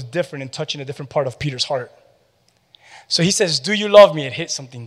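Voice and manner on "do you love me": forceful question. Acted. Jesus's question acted, loud.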